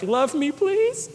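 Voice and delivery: high, slow, soft begging whimper; pleading dog voice. Pretend. Ashamed dog begging its owner for love, high and slow. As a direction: beg like an ashamed puppy. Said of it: "tail thumping against the ground like".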